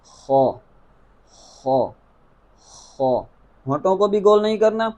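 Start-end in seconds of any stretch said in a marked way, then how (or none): 0.0-3.2 خو moderately full, lips unrounded; correct kha. Shown as a demonstration. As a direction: keep خو full but not rounded. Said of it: "درست طریقہ ادائیگی آپ ذہن میں رکھیں". (خو درست، درمیانہ پر)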